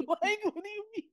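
high-pitched incredulous squeak; mock disbelief. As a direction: shoot the voice way up in disbelief.

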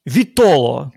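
«Вітоло» said loud and set apart, with marked stress; deliberate word stress. Shown as a demonstration. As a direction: punch out «Вітоло» to show its stress. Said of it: "наголос такий стоїть". («Вітоло» виразно, з підкресленим наголосом)

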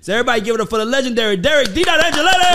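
loud, fast, rising announcer build; hype introduction. Real, not acted. Host hypes up his mentor to the crowd, big announcer shout. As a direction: bring the guest on like a hype man.

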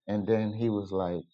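ordinary delivery

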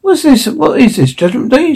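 high-pitched, mildly snappish questions; snapped. A narrator's reading. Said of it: "snapped Vifford".

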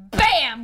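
imitated smack. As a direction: punch out the sound of a slap. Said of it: "and I was like".

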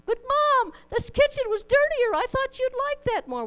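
put-on high-pitched whiny child pleading with Mom; protesting kid. Kid defending the cleaned kitchen to Mom, high-pitched protest. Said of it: "If I tell my kids".

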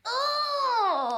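high delighted gasp of joy; a burst of happy surprise; excited. Real, not acted. Delighted by guest's song pick.

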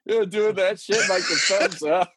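high, laughing through words. Real, not acted. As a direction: giggle while describing it. Laughing about Matt Hardy spot.

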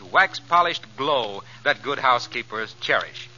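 normal talking voice

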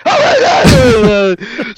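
jolted awake in a panic; startled groggy yelp. Retold or imitated. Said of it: "I was like".

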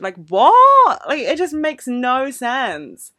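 loud, high exasperated outburst; loud disbelief, slowing into emphatic frustration; incredulous. Real, not acted. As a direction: burst out baffled, then drag out the complaint. Incredulous at people claiming OCD.

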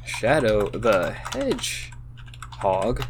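very slow, drawn-out word by word; typing aloud. Real, not acted. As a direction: say each word as you type it. Saying words while typing them.

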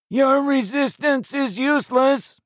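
menacing alien through a speaker; croaking metallic voice. Acted. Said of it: "a croaking metallic voice".

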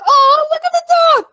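high, loud cooing; gushing. Retold or imitated. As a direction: squeal over the dog. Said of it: "I was like".

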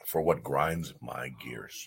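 low, slow, hushed segment announcement; dramatic slow segment intro; segment title read. Acted. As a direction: drop it low and drag it out like a show title. Announcing the recurring rant segment.

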